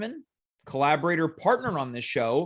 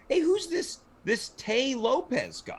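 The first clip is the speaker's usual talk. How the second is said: higher, lightly voiced puzzled viewer; curious audience member; 7 semitones higher than usual. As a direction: ask it like a baffled fan at home. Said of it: "They might be thinking".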